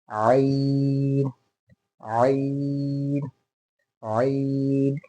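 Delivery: very long आइन from a squeezed throat; as if throat pressed. Shown as a demonstration. Teacher drilling students, long आइन as if strangled. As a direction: choke the onset, then hold आइन slowly. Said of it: "ऐसा लगे कि आपका गला किसी ने".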